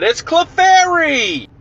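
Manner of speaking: high, slow cartoon TV reveal; Pokemon TV announcer. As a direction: stretch it like the show's catchphrase. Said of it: "and it said".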